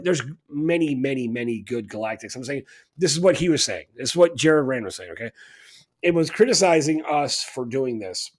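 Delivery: usual speaking voice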